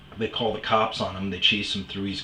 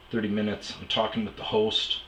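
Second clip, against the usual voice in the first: ordinary delivery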